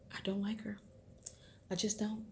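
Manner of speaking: breathy whisper, no voice at all. Real, not acted. Vlogger confides her dislike of the mother, whispering. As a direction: let it slip out as a secret whisper.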